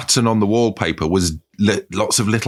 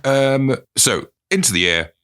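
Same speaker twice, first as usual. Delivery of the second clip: ordinary talk, nothing marked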